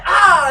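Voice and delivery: high, loud battle cry; enraged cry. Acted. Bandit sees who killed his cousin, raging cry. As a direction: let out a furious shout.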